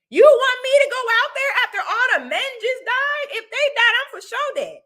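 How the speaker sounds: put-on high, loud disbelief as a reluctant girl; incredulous girl. Vlogger voicing a drafted girl, high and loud incredulous. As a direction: play the girl being asked to fight, high and loud. Imagined young girl refusing war.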